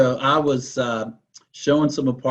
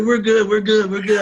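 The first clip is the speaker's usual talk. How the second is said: high, loud, laughing through the words; mock panicked couple, laughing as you say it; quoting the couple's reply while laughing; laughing quote; 9 semitones higher, 5 dB louder than usual. Couple's reply in retold story.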